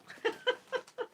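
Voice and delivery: very high, rippling giggle; real high-pitched laugh; amused giggle. Real, not acted. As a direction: giggle at being teased. Laughs at talk about her accent.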